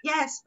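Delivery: YES starting high and going down; falling tone. Shown as a demonstration. Teacher showing fourth tone, falling happy YES. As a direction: drop firmly through YES. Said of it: "So going down".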